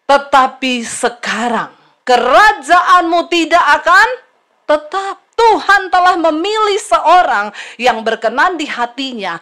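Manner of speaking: forceful, drawn-out pronouncement; declaimed verdict. Acted. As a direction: proclaim it like a prophet passing sentence. Declaims prophet's verdict on Saul. (vonis lantang seorang nabi)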